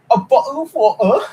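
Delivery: bottle of water with the t's dropped, high and laughing; hammed-up British meme voice; mock British accent. Streamer mocking the British bottle of water meme in a giggly British accent. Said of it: "that's always such a meme".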